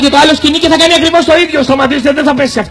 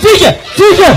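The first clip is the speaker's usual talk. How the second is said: shouted command; 7 semitones higher than usual. Shown as a demonstration. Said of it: "Πείτε το όλοι μαζί".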